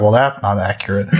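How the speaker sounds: deadpan the critique; low, quiet dry remark. Retold or imitated. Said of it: "I was like".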